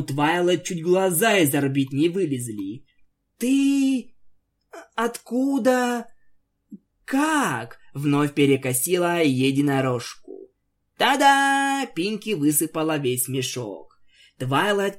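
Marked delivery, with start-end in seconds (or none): 3.4-7.8 high, halting, astonished; lightly voiced pony shocked at the huge sack; stunned. 11.0-11.9 triumphant. Acted. Pinkie revealing sack.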